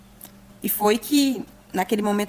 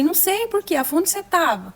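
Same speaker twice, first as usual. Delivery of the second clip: startled question; 8 semitones higher than usual. Retold or imitated. Mothers ask her about Miguel.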